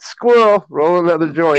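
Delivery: high, loud sudden blurt; distracted stoner. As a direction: snap out of it loudly with a new idea.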